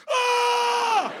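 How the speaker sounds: high, loud terrified shriek; panicked scream. Retold or imitated. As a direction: shriek like the plane is going down. Passenger panicking in a crash.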